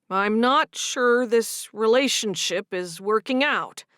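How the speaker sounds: slow, heavy, deliberate words; slowly. Acted. Matthew slowly tells Sigrid it's over. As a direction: weigh each word before saying it. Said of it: "he said slowly".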